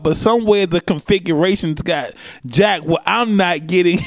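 ordinary delivery

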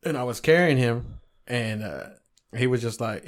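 normal talking voice

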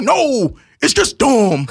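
mock an indignant Southern sleuth; hammed-up Southern accent; broke Morgan Freeman. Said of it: "You sound like a broke Morgan Freeman".

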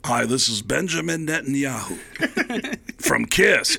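a light Netanyahu-style [name] voice; [name] impression. Comic showing friend the [name] voice, lightly impersonated. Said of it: "It's the same voice".

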